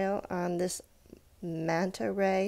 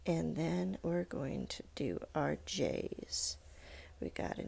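whisper; 3 semitones lower, 9 dB quieter than usual. Real, not acted. Unvoiced slow whisper.

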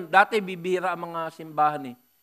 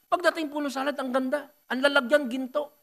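usual speaking voice

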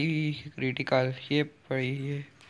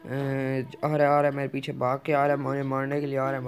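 ordinary delivery

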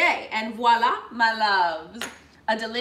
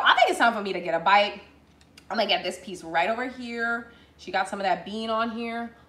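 ordinary talk, nothing marked

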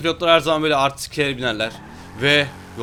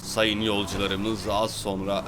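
lower, softer, slow cabin announcement; mock captain's cabin announcement; pilot announcement voice; 8 semitones lower, 6 dB quieter, much slower than usual. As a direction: address the passengers like an airline captain. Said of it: "Bir pilot gibi konuşayım mı?".

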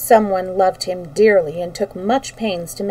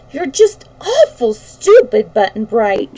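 high, loud, drawn-out exasperation; exasperated despair; 4 semitones higher, 5 dB louder, slower than usual. A narrator's reading. Dorothy despairs of Button-Bright's answers, exasperated. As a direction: scold slowly and emphatically, pitched up in despair. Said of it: "Dorothy was in despair".

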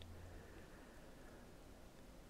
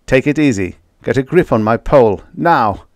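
louder, raised-pitch rescue orders; urgent, steadying shout; urgent command; 4 semitones higher, 6 dB louder than usual. A narrator's reading. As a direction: call out firm instructions to someone in danger.

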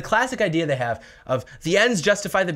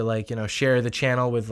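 ordinary talk, nothing marked

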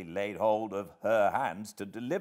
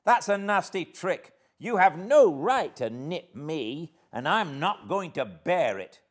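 high, loud indignant scolding; high-pitched, forceful outrage; indignant protest; 10 semitones higher, 9 dB louder than usual. A narrator's reading. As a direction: protest loudly at being wronged. Scolds after being nipped.

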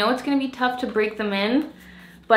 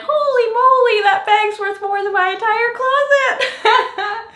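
much higher, louder, slow amazed mom; mom's amazed exclamation; 9 dB louder, slower than usual. Retold or imitated. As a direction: voice mom's stunned delight. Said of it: "She's like".

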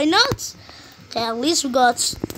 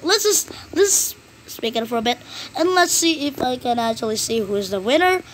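ordinary delivery

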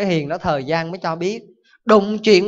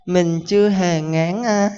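very slow calm monk; slower than usual. Acted. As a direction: answer serenely and drag every word. Calm monk replying to the father.

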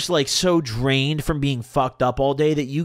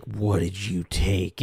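slow, low, hushed mom anger; imitated restrained anger; 9 semitones lower, 5 dB quieter, much slower than usual. Mom questioning her kid with family still there, quiet slow anger. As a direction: imitate mom pissed but not yelling, drawn out. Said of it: "I heard it in her voice".

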